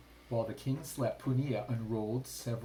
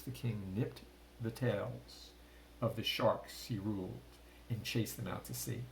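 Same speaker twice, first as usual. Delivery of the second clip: usual speaking voice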